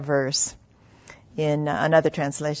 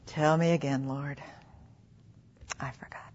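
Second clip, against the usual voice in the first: soft, low, fading away; hushed confession; 5 semitones lower, 7 dB quieter than usual. Acted. Believer admitting forgetfulness to the Lord, hushed confession. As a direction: let the prayer drop to a very quiet admission. Voices own sheepish prayer to God.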